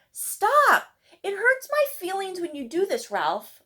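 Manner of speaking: put-on a girl finally yelling at her bully; high, loud plea to stop; pleading shout. A narrator's reading. Lucy stands up to teasing.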